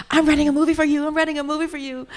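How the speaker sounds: rapid, high excited director voice; a hint of a hyper fast talker; fast-talking Quentin. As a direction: rattle it off like a kinetic director. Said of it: "you know how fast he talks".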